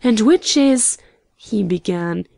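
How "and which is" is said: raised, louder eager question; keen, higher-pitched query. A narrator's reading. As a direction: start asking eagerly.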